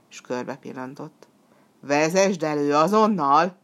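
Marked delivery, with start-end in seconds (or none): 1.8-3.6 loud demon's order; acted angry command; menacing command. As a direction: demand it at once, forcefully.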